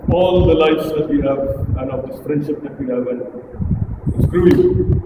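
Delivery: monotone drone, little lift in pitch; boring speaker. Shown as a demonstration. Coach showing the audience a boring speaker, monotone. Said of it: "That's what a boring person does".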